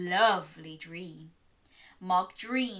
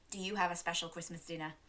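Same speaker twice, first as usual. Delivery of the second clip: ordinary delivery, nothing marked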